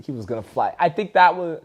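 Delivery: ordinary talk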